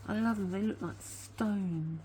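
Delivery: ordinary delivery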